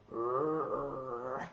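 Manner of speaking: low, soft growl in a voice unlike her own; big wolf growl. Pretend. Storyteller voicing the big wolf to the girl, low soft growl. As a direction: rumble a warning like a big wolf. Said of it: "the big wolf says".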